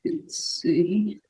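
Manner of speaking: whispered aside to herself. Real, not acted. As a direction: breathe it under your breath. Muttered aside while sharing screen.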